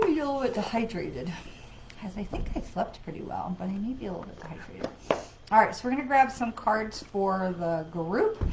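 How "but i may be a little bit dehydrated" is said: quick whispered aside; hushed fast repeat. Real, not acted. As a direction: murmur it fast to yourself, under your breath. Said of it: "forgive me for the yawning".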